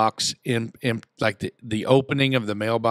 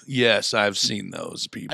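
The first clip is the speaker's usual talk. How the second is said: usual speaking voice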